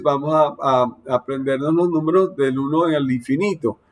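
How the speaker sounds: usual speaking voice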